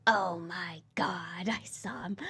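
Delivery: breathless stunned whisper; awed whisper. Retold or imitated. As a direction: whisper it as if seeing yourself for the first time. Realizing own flaw, whispered.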